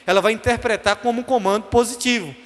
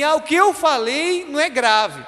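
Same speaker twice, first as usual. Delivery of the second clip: higher, slower self-justifying whine; defensive protest; 6 semitones higher than usual. Acted. Someone defending their words after offending, higher protesting tone. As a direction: insist it was nothing serious.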